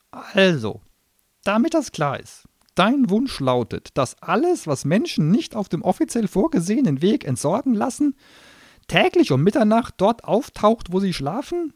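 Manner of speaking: high, loud clerk-like gnome voice; businesslike gnome. Acted. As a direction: recite the terms like a contract.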